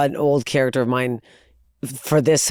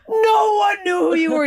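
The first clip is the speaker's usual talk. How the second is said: dismayed wail. Retold or imitated. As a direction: cry it out high in disbelief. Acts out own dismayed reaction.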